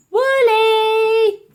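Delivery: high, loud call across the hall. A narrator's reading. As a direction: call her name loudly. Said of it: "called out the girl".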